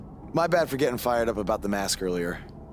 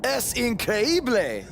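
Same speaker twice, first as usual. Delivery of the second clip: showing off Spanish; 10 semitones higher, much slower than usual. Acted. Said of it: "I took a few years of Spanish".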